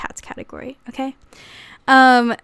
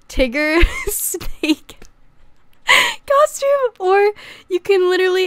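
laughing; 8 semitones higher, slower than usual. Real, not acted. To viewers about a Tigger snake costume, squealing laughter. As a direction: voice jumping much higher, slowed through laughter.

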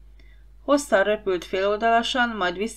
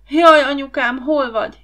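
high, loud cry for mother; alarmed shout, easing slightly at the end; frightened cry; 6 semitones higher, 9 dB louder than usual. A narrator's reading. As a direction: wail out in fright like a scared child. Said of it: "kiáltott Ariel".

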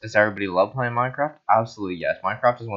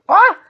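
high, loud yelp; short scream; 10 dB louder than usual. Real, not acted. Player caught out in the game, short scream. Hit during play.

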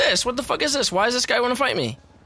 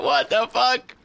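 ordinary talk

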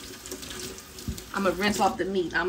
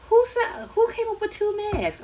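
incredulous; 13 semitones higher than usual. Real, not acted. Outraged at double masking news.

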